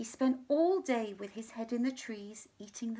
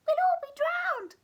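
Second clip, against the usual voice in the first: much higher, louder panicked squeak; squeaking mouse; 10 dB louder than usual. Acted. Mouse squealing in panic at the flood. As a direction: squeal like a tiny frightened mouse. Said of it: "squeaked the mouse".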